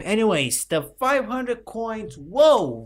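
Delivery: cracking high squeaky voice. Real, not acted. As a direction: voice breaking up high, slowly. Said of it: "voice cracks during night".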